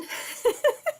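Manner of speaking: high, breathy burst of laughter. Real, not acted. Host forgets what she read yesterday, amused laugh. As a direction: laugh at your own forgetfulness.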